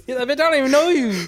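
words bursting out mid-laugh. Real, not acted. Friends laughing at the story.

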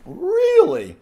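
high-pitched mock disbelief; retold incredulous outburst. Escalated disbelieving comeback, retold.

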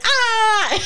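high squeal with a laugh; laughing cry of joy. Retold or imitated. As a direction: squeal of delight. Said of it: "And I'm like".

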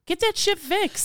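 high-pitched mock annoyance; playful exasperated outburst; high exasperated demand. Mock outburst about faulty alarm.

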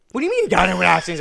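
loud, rushed, incredulous reaction; mock a brother's startled outburst, very fast; brother's alarmed question. Brother hearing of the car accident from Brendan, alarmed.

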